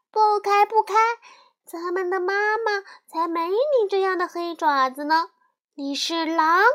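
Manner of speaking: 高声尖叫的小羊, 坚决拒绝; 小羊们尖着嗓子大喊不开; 小羊们叫起来. A narrator's reading. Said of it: "羊儿们一见就叫起来". (小羊们看见黑爪子高声叫喊)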